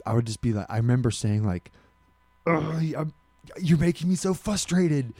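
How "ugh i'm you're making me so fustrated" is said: higher, exasperated complaint; mock-upset whine, "frustrated" without its first R; retold exasperation. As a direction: act out being fed up in a squabble. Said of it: "when I was mad".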